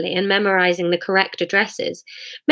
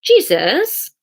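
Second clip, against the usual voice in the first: Hamish voice; 6 semitones higher, 4 dB louder than usual. Acted. Hamish asks Jesus.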